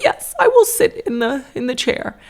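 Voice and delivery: put-on very high, tearful little boy voice; imitated quiet crying. Son giving in to the hygienist, high and tearfully brave. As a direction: mimic a child agreeing through controlled tears. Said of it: "quietly crying".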